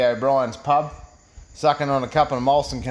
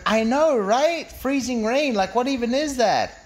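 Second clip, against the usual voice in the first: high-pitched gushing woman's voice; mock-girly commiseration, pitched way up; chatty agreeing woman; 9 semitones higher, slower than usual. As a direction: lift it right up for eager small talk. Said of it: "And she went".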